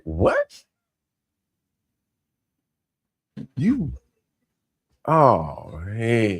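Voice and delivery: imitating the cop's startled reaction; mock surprise. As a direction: stammer it out like a baffled officer.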